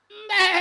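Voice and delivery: loud high bleat; goat bleat. Shown as a demonstration.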